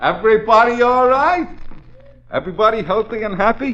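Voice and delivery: high, bright sarcastic cheer; mock cheerful. Officer checking on the prisoners, mock cheerful. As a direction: greet them like a jolly host.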